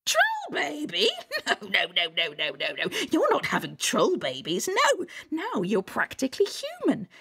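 swooping high troll voice with giggles; excited Mother Troll. Acted. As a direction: scoff at the idea, then squeal dreamily.

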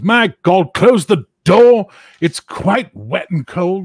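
high, loud, slow cry to shut the door; fussy barkeep yelling at the draught; high loud innkeeper shout; innkeeper's alarmed shout. Acted. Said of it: "He goes".

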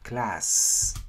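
CLASS with a long, audible S at the end; clear final S. Shown as a demonstration. Teacher reminding learners of the last sound, final S pronounced clearly. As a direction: finish CLASS on a full hissed S. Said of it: "Nhớ âm S cuối cùng".

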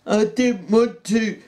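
high, slow tearful protest; Lenny crying. A narrator's reading. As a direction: cry it out. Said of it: "Lenny cried".

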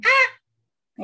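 sudden loud high yelp; short piercing mock shriek; imitated rat scream. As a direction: squeal abruptly like a touchy rat. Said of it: "Y de repente le hacen".

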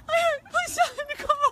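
slow, high, crying; sobbing plea. Real, not acted. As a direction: choke out the request for help. Crying, asking bystander for help.

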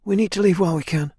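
high, quick character voice; urgent plea. A narrator's reading. Swordswoman urging the leader to leave, urgent.

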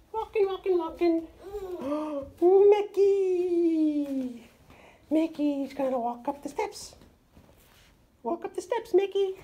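high, sing-song toy mouse voice; playful Mickey voice. Pretend. Parent voices Mickey toy for toddler.

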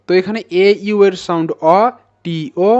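usual speaking voice

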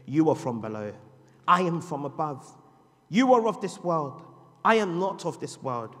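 loud, firm declaration; preaching. Acted. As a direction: pronounce each claim. Jesus' words preached loudly.

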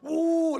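high, loud pained "ooh" in imitation; mock labor groan. Shown as a demonstration. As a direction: groan like a woman hit by a contraction. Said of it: "like that".

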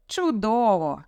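mildly mocking approval; sarcastic. A narrator's reading. Father mock-applauding daughter's decision, sarcastically. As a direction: say it with dry irony. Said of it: "батько саркастично сплеснув у долоні".

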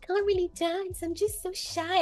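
lightly mimicked bashful dancer, high and soft; imitating shy dancer. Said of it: "he doesn't talk like that".

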